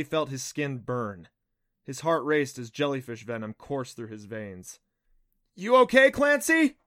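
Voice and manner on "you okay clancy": high, loud worried call; hollering. A narrator's reading. Adam checking on stung Clancy, hollering. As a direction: holler it across to a friend. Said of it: "he hollered".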